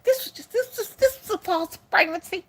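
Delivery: imagined doctor in a high, thin pretend voice; wishful doctor. Doctor telling her it was false, wishful high voice.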